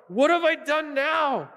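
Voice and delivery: put-on high, slow whiny kid brother; whiny little brother. David answering his big brother, high and whiny. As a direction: protest like a wronged little brother. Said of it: "such a little brother thing to say".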